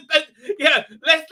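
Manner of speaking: normal talking voice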